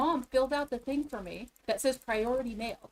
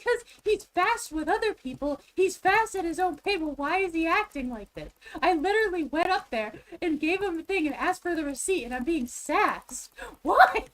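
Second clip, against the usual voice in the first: raised, high exasperated voice; indignant rant; 6 semitones higher, 5 dB louder than usual. Real, not acted. Protesting the clerk's treatment, worked up and indignant. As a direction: build to a loud, high cry on the last word. Arguing against mom's excuse, ranting.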